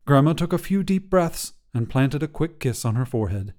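ordinary delivery, nothing marked

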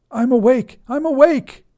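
high, loud terrified shout over the fire; panicked scream. A narrator's reading. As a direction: scream it while dangling over flames. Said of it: "the father screamed".

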